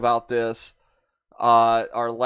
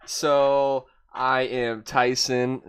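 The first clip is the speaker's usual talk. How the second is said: ordinary talk, nothing marked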